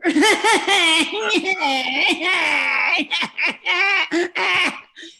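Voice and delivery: loud, high mock wailing sob, half laughing; ugly crying wail. Comic showing the audience her ugly crier, loud mock wail. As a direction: ham up an ugly cry, wailing. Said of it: "I'm like an ugly crier".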